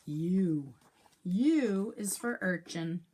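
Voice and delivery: normal talking voice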